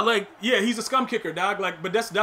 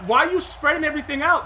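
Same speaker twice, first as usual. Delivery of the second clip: ordinary talk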